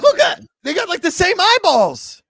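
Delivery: high, shouted exasperated insistence; loud, high and drawn out at the end. Real, not acted. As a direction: yell your proof at a doubter. Insisting on resemblance, loudly.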